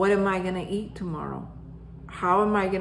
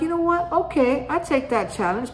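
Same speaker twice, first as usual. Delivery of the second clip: higher, defiant and game; taking up the challenge; 7 semitones higher than usual. Retold or imitated. Accepting his friend's challenge to read, defiantly. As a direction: accept the dare with spirit. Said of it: "now I'm in a different mood".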